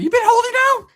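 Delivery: very high, loud disbelief; retold indignant squawk; accusing outburst. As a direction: confront a friend who kept a secret. Confronts friend about hidden drugs.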